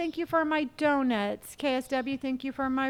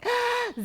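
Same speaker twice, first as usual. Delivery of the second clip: gasp; 11 semitones higher than usual. Real, not acted. Sees a friend arrive.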